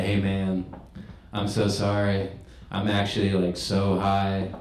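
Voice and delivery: soft, low, sheepish apology; embarrassed confession. Retold or imitated. Stoned customer to sandwich guy, embarrassed honest apology. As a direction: own up quietly, with halting pauses. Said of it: "I was so embarrassed on that one".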